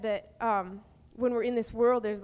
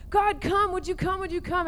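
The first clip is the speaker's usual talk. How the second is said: higher, louder pleading call; crying out; 7 semitones higher, 4 dB louder than usual. Shown as a demonstration. Teacher shows desperate prayer for a dying friend, pleading loudly. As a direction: beg God to come. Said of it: "we're crying out".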